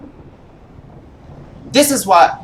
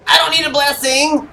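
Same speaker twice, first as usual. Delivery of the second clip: high, indignant refusal; 9 semitones higher than usual. Real, not acted. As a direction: snap back, offended. Rejecting an offer indignantly.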